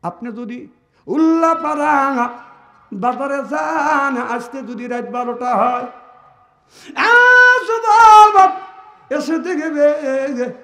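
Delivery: sung, surging sermon chant; preaching at full force. Acted. Preacher telling Fatema's death to the gathering, chanting. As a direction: chant the story to the crowd.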